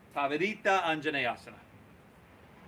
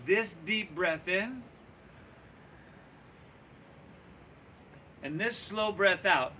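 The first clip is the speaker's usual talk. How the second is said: slow guiding breath cue; 2 semitones higher, slower than usual. Real, not acted.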